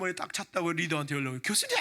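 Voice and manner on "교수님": much higher, delighted shout of teacher's title; excited call. Retold or imitated. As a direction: call out to the professor in glee. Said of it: "막 그러면 좋아가지고".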